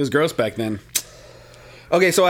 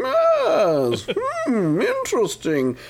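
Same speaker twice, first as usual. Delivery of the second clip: mock villain musing; 9 semitones higher, much slower than usual.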